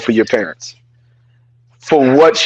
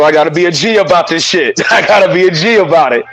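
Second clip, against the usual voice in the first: ordinary delivery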